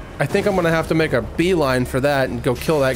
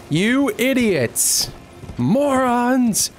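raised-pitch insults; 7 semitones higher, much slower than usual. Real, not acted. Insulting allies.

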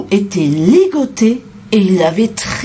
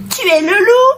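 put-on very high little-girl voice; little girl's cry; 13 semitones higher than usual. A narrator's reading. Little girl recognising the wolf, crying out. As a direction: call out in a high child's pitch. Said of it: "cria la petite fille".

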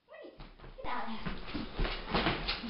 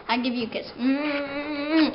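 ordinary delivery

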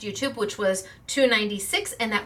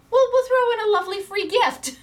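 lightly voiced sweet boss, pitched up high; high mock Kimberly; 12 semitones higher, 4 dB louder than usual. Owner quoting her boss offering free gifts, high sweet mock voice. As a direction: chirp it up high like a generous boss. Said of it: "she said".

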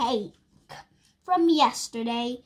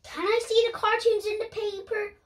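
put-on high child voice; 9 semitones higher, faster than usual. Laura asks Mum.

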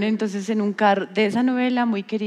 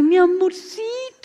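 1 gushing greeting; 10 semitones higher, slower than usual. Retold or imitated. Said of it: "Entonces yo le dije".

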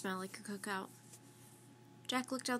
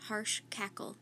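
ordinary delivery, nothing marked

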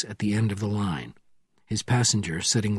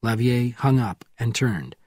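ordinary delivery

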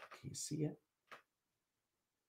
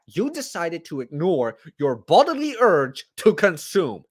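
emphatic, higher-pitched scolding; indignant rant; 4 semitones higher, 3 dB louder than usual. Acted. Diner scolding friend who wants his food, indignant emphatic rant. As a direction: lay into them with mock outrage.

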